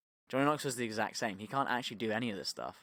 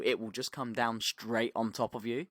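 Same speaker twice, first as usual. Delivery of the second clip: normal talking voice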